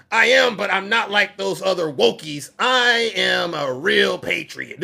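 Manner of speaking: hammed-up patriot yelling at Putin; proud patriot shout. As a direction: bellow your loyalty. Man boasting to Putin.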